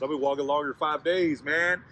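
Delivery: loud, high-pitched disbelief; acted dismay at full volume; alarmed realisation. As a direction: cry it out as a lost man panicking. Lost man realises how far.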